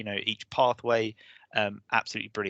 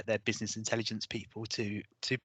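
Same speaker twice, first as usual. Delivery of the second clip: hoarse voice giving out; strained, failing voice; losing voice. Real, not acted. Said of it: "Sorry, I'm losing my voice".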